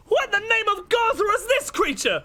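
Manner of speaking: high, outraged villain cry; outraged shout. Acted. Burning foe cries out at a lizard creature, outraged shout. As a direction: shriek it in indignant horror.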